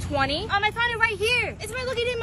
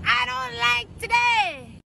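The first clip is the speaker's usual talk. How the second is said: higher, slow drawn-out whiny yell; tantrum wail; 5 semitones higher, much slower than usual. Acted. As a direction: wail it like a sulking child. Kid's catchphrase tantrum.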